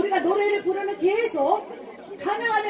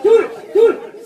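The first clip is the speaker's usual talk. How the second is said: louder, higher shouted count; barking gym coach; 4 semitones higher, 10 dB louder, much slower than usual. Real, not acted. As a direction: punch each count like a drill coach. Coach counting exercise reps.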